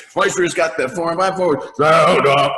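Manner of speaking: big, gruff, gravelly call, high, loud and slow; gruff sergeant voice. Shown as a demonstration. The sergeant calls out to the soldiers in the Chrysler commercial, gruff. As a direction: boom it out like a drill sergeant in a car ad. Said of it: "big, gruff, gravelly voice".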